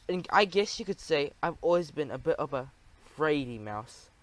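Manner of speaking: ordinary delivery, nothing marked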